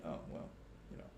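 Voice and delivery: breathy mock shrug of a reply; mock dismissive voice. Mimicking viewers' dismissive reply, soft and quick. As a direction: toss it off like it's nothing. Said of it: "and saying".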